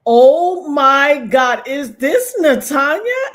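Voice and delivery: high, drawn-out, loud starstruck question; amazed recognition. Retold or imitated. Host imitates Kayla realizing who called, amazed. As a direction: stretch it out in acted astonishment.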